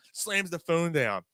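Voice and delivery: ordinary delivery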